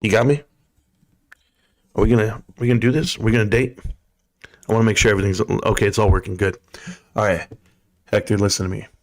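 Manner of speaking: low, soft seductive purr; sexy voice. Pretend. Streamer croons low and seductive to a viewer. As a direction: croon to Hector like a lover. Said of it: "I'll give you my sexy voice".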